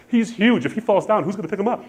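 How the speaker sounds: high-pitched mock wail of a worried wife; hammed-up high, anxious fretting; wife crying. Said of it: "she's crying 'cause she's like".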